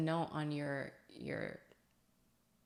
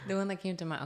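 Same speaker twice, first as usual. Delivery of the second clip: louder, higher, full voice; speaking up; 5 semitones higher, 5 dB louder, much faster than usual. Shown as a demonstration. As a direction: project it out clearly now. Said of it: "You just gotta talk, dude".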